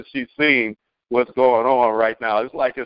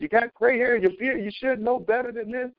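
put-on mad young man; 8 semitones higher than usual. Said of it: "he was mad".

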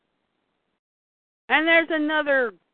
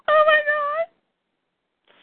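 very high, loud, drawn-out cry; high outcry; 5 dB louder, much slower than usual. Retold or imitated. Crying out as the injured woman is held, high outcry. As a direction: wail it out, pitch leaping up.

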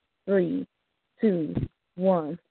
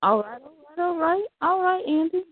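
high, rising hype call to the crowd; hype host call; 9 semitones higher than usual. Real, not acted. Battle host hyping up the crowd with a high call. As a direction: pump up the room like a battle host.

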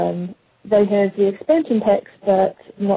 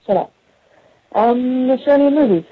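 usual speaking voice

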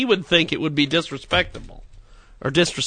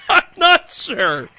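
laughing; 10 semitones higher, slower than usual. Real, not acted. Laughing through his words.

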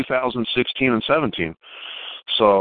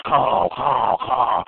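eight-hundred-pound crow; much slower than usual. Retold or imitated. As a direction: caw like a huge, heavy crow. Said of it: "that eight hundred pound crow goes".

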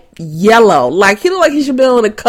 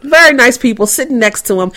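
ordinary talk, nothing marked

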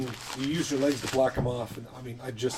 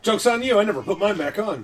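gleeful retort; 8 semitones higher, 6 dB louder than usual. Acted. Comic retort in game.

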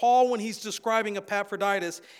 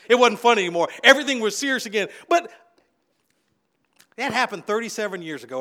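usual speaking voice

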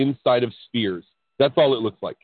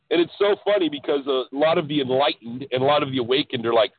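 usual speaking voice

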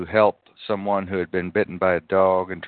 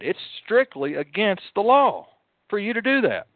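heated retort; 11 semitones higher than usual. Retold or imitated. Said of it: "I said".

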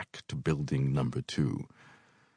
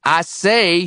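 put-on cranky old man; 13 semitones higher, 10 dB louder than usual. A narrator's reading. Brockway begins.

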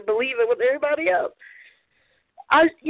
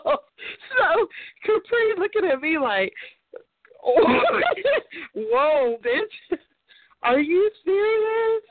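high-pitched laugh-filled retelling; laughing through the friend's shocked reaction; 7 semitones higher, much slower than usual. Real, not acted. Laughing while telling listeners how Capri reacts.

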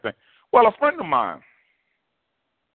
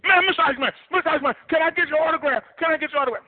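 much higher, fast, gushing plea; starstruck begging; much faster than usual. Retold or imitated. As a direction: rush the autograph request like a giddy kid. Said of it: "He lost it".

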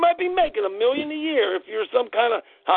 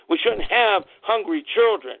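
normal talking voice

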